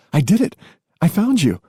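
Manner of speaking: high, bright cry of triumph; thrilled she found him; excited. Acted. Gia cries out in delight.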